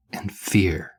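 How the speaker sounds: "and fear" set apart after a pause, hushed; dramatically offset. A narrator's reading. Reading Patrick's terror aloud, "and fear" dramatically offset. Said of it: "it was just funny 'cause it was offset".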